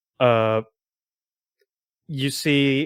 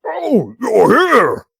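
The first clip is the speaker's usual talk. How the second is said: loud, high, drawn-out shout of welcome; booming cheerful tavern greeting; cheerful Eglith greeting; 12 semitones higher, 9 dB louder, much slower than usual. Acted. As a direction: holler a big slow hello across the tavern. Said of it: "Eglith, like, goes".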